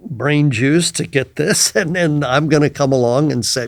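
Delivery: ordinary talk, nothing marked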